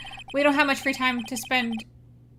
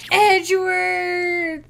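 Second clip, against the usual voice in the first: long drawn-out loud cry, raised pitch; calling out; 7 semitones higher, 9 dB louder, much slower than usual. Acted. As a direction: holler the name, stretching it right out. Spotting Edgeworth, calls his name.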